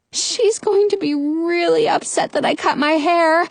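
high, fretful moan. A narrator's reading. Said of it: "Rapunzel moans".

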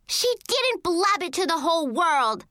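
high-pitched angry retort; indignant yell. A narrator's reading. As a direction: yell a correction at your brother. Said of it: "I yelled".

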